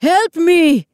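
high, loud distress yell; urgent, drawn-out shout; yelling for help. A narrator's reading. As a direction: yell to draw rescuers toward you. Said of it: "he yelled".

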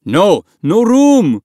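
high, loud yell; shouted refusal. A narrator's reading. Dinghy passenger to the pleading boy, shouted refusal. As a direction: shout them off.

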